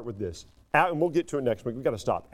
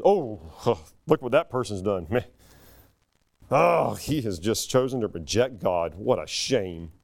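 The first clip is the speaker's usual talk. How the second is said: smug judging mindset; 7 semitones lower than usual. Retold or imitated. Preacher mimicking a judgmental believer, mock smug. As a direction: sneer like a self-righteous churchgoer. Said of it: "And our mindset is".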